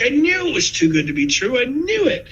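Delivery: high, whiny letdown, pitched well up; mock dismay. Friend grumbles it's too good to be true, high and whiny. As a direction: play the friend who feels cheated. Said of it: "don't go crying in your beer".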